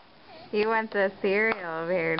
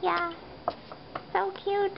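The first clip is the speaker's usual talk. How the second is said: cooing; 10 semitones higher, 4 dB quieter than usual. Real, not acted. Cooing at baby.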